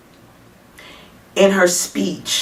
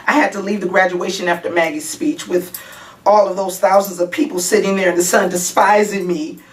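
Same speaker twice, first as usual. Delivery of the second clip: usual speaking voice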